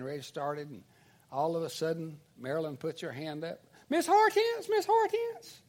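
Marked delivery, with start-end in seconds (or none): 3.9-5.6 very high, eager mock calling; calling schoolgirl. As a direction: imitate a pupil seeking teacher's attention. Classmate calls teacher, acted.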